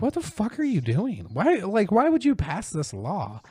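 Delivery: high-pitched outraged rant at lawmakers; mock exasperated rant. Ranting at lawmakers who passed the law, high mock outrage. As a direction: squeal it out in disbelief. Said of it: "I was like".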